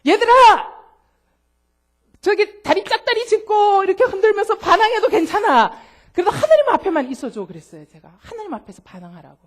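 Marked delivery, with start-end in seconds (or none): 0.1-7.5 put-on high, loud call to the kids; preacher hollering to rebellious teenagers; calling out to teens. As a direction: shout it across the youth room. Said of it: "뭐라 그랬냐면".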